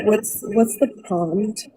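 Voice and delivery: ordinary delivery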